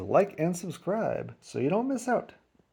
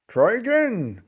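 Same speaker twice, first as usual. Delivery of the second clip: grandpa; 5 semitones higher, 3 dB louder than usual. A narrator's reading. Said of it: "Grandpa said".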